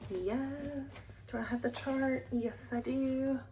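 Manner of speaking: ordinary talk, nothing marked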